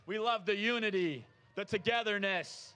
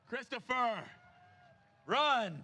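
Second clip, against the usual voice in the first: calling out; 5 semitones higher, slower than usual. Real, not acted. Urging a runner to sprint.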